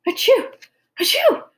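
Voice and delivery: very high, loud sneezes; acted sneeze. A narrator's reading. Mother rubbing smarting eyes in pepper cloud, acted sneezing. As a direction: explode into two squeaky achoos. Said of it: "rubbing her smarting eyes".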